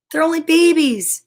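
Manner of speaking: plain reading voice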